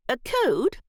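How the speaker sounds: lightly voiced stern aunt; a hint of a haughty older lady. Aunt Em voiced, far timbre.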